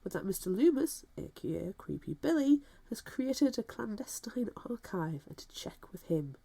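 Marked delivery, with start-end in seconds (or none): 2.9-6.3 hushed conspiratorial whisper. Pretend. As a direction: breathe it like a secret. Conspiratorial whisper about secret archive.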